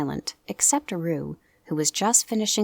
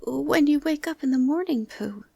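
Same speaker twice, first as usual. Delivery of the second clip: Piglet's voice; 9 semitones higher, 4 dB louder than usual. Acted. Piglet asks Pooh.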